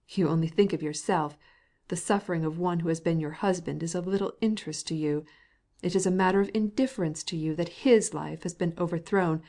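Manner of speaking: ordinary delivery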